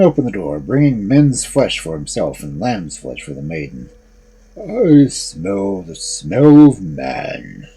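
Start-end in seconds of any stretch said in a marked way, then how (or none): 4.6-7.7 slow, loud, booming ogre voice; thundering ogre. A narrator's reading. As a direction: boom it out like an ogre. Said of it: "he thundered".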